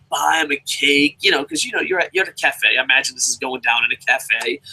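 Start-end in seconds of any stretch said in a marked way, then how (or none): none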